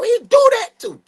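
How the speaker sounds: high, loud hype shout of praise. Real, not acted. Hyping his friend's talents to chat, loud high shout. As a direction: shout it up to hype your brother.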